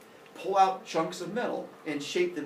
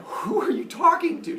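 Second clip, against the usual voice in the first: high, loud disbelieving question; incredulous; 6 dB louder than usual. Acted. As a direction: react in comic disbelief, voice shooting up. Incredulous Father Sky.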